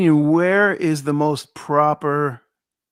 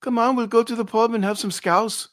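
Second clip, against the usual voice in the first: higher-pitched mock Scouse; Liverpool accent attempt; 6 semitones higher than usual. Host improvising a pub invitation, mock Liverpool accent. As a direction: play a Scouser inviting a mate out. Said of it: "in a Liverpool accent".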